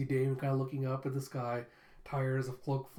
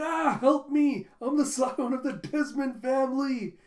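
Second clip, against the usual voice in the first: much higher, louder, slow desperate cry; a hammed-up much higher cry for help; panicked plea; 10 semitones higher, 8 dB louder, slower than usual. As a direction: beg for rescue while flailing. Damien drowning, panics.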